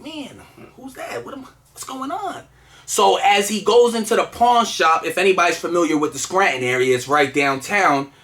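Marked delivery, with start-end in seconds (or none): 0.0-2.4 very soft, higher questioning aside; wondering quietly to himself; puzzled undertone. Retold or imitated. Puzzled by the man's crazy look, hushed questioning.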